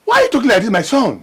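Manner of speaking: father, shocked at his son's rejection. Acted. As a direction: ask it stunned and hurt.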